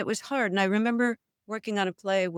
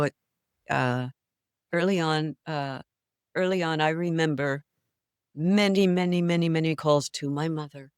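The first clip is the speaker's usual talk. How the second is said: ordinary talk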